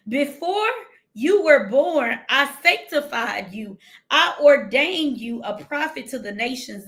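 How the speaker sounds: scripture reading. Acted. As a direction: read it with weight. Reads scripture.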